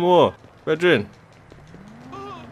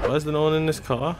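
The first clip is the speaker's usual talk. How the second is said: ordinary delivery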